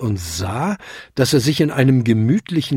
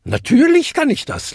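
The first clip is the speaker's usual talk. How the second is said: very high, amused reply; high-pitched, lightly laughing assurance; laughing old lady; 3 dB louder than usual. A narrator's reading. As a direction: toss it off with mirth, pitched high. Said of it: "lachte sie".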